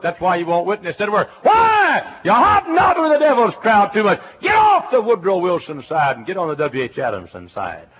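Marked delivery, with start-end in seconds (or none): 1.4-7.9 loud, high shouted exhortation; fiery preaching. Real, not acted. Preacher warning the congregation about the wrong crowd, shouting high. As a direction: thunder it at the congregation.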